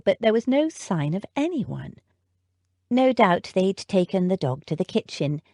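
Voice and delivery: usual speaking voice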